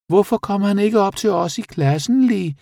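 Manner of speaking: very high little bat voice; bat character. A narrator's reading. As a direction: ask like a squeaky little bat. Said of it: "spurgte flagermusen Senia". (høj, pibende flagermusestemme)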